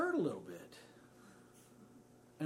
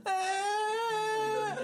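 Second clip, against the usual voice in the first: imitated long shriek, much higher and louder; child's scream; 7 dB louder than usual. Emily hurt upstairs: imitated shriek. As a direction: let out a kid's piercing scream.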